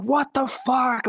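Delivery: high, drawn-out mock scream; stretched high-pitched mock yell; retold scream. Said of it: "starts screaming 'cause they're like".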